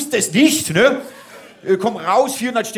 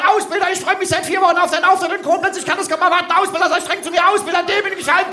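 very high, rapid, breathless-fan gush; gushing loud fan; 11 semitones higher, faster than usual. Acted. Instructor showing a fan how to cheer him, gushing and high. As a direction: rattle it off high like an overexcited admirer. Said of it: "rufst ganz laut".

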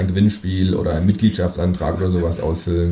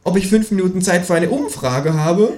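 higher, mocking kangaroo voice, with a laugh; 11 semitones higher, slower than usual. A narrator's reading. The kangaroo mocks a caller on the phone, high and slow. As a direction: drawl the answer slowly and mockingly.

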